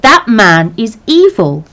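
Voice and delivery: slow, loud and cold condemnation; father condemning the neighbour, loud and slow; angry. Acted. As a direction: spit out the verdict, slow and loud.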